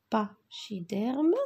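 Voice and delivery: "pachydermes" drawn out slowly, split after "pa"; sounding out syllables. Shown as a demonstration. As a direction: say "pachydermes" slowly, pausing after the first syllable, ending on a rise. Teacher sounds word out for accents.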